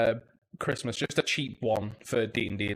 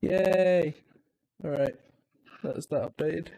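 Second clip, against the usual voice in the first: normal talking voice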